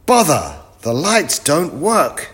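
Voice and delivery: loud, high exasperation; vexed professor. Acted. Professor finds the lights broken, loud high vexation. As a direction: exclaim crossly, high and loud.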